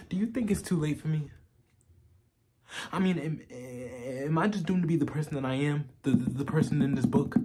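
halting, earnest plea broken by long pauses; BoJack Horseman. Acted. Asking Diane if it's too late for him, halting and pleading. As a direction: vulnerable confession, searching for reassurance.

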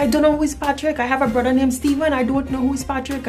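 high, quick, baffled protest; indignant denial. Retold or imitated. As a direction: re-enact a confused kid insisting. Acts out younger self protesting.

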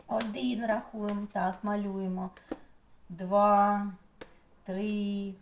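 ordinary talk, nothing marked

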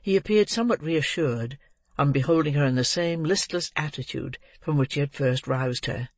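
usual speaking voice

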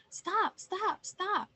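high, insistent repeated cry; storybook goose in a big flap; flustered cry. A narrator's reading. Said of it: "cried the Google-eyed goose in a".

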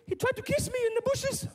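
very high little-daughter voice; squeaky-high mock daughter telling her story; upset daughter. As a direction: put on a high-pitched upset girl. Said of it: "she's looking all dishevelled and upset".

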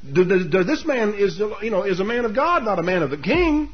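high-pitched, incredulous mock questioning; acted-out sarcastic challenge, pitched high; sarcastic disbelief. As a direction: scoff in exaggerated disbelief. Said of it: "The sarcasm is very implicit".

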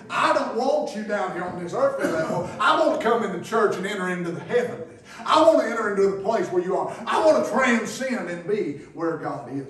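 loud, high, ringing preacher's cry; shouting sermon in full flow; preaching at full force. Acted. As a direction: whip the congregation up from the pulpit.

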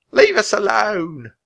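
slow, high, loud protest; drawn-out yell at the birds. A narrator's reading. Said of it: "a woman yelled at the ravens".

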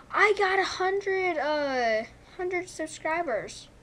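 thrilled at the milestone; excited gasp. Real, not acted. Gamer reacting to a hundred subscribers with a real excited gasp.

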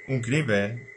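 normal talking voice

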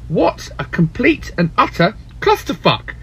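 ordinary talk

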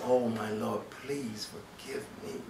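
the repentant king begging his Lord, hushed; soft plea. Acted. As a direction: ask forgiveness barely above a breath. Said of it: "He says".

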